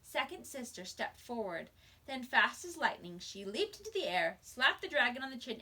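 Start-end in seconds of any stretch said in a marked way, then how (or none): none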